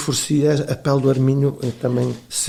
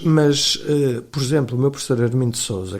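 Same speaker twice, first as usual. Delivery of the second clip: ordinary talk, nothing marked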